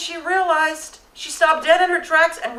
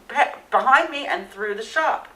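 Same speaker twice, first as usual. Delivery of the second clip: ordinary talk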